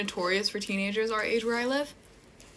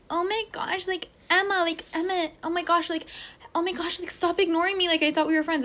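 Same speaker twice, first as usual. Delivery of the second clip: high-pitched, whiny mock voice; exaggerated high girly imitation; imitated pestering girls; 8 semitones higher than usual. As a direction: mimic girls insistently calling for attention. Mimics girls pestering her at concert.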